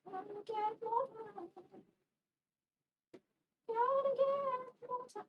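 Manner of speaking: high, slow sing-song whine; high sing-song plea. Real, not acted. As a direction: croon it up high, drawn out. Pleading for more time.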